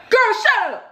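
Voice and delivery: high-pitched mock yell; imitated yelling sister. Sister pushing her to go fight, high mock yelling. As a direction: holler an order in a high mimicking voice. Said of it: "she just yelling at me".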